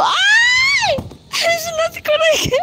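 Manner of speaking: sudden high, loud startled shout; real shout. Real, not acted. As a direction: cry out in shock as the ball goes wrong. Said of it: "Sorry for shouting".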